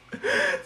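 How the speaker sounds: short high squeal of laughter; a high giggle bursting out; real squealing laugh. Real, not acted. Laughs again.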